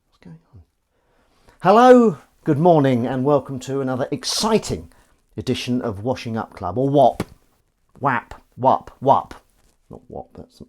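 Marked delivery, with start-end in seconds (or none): 1.6-9.3 loud, bright presenter welcome; show host intro. Acted. Greeting viewers of his Washing Up Club show as a hammed-up host. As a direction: open the show like a cheesy TV host.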